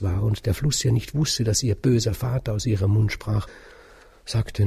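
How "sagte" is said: usual speaking voice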